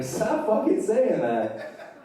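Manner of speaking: slow, high-pitched exasperation; exasperated outburst. Acted. Freaked-out friend fed up with the best-night line, exasperated outburst.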